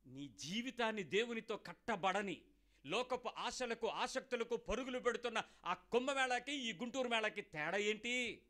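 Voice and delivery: slow, deliberate preaching with weighty pauses; emphatic preaching. Acted. Preacher challenging the congregation, slow and emphatic. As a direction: spell it out slowly to the crowd.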